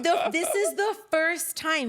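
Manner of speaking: laughing. Real, not acted. Laughing while telling.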